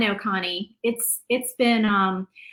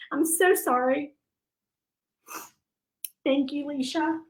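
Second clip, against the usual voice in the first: tearful; 5 semitones higher than usual. Real, not acted. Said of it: "while you're crying".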